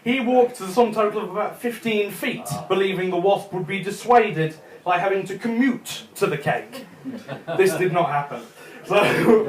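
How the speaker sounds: ordinary delivery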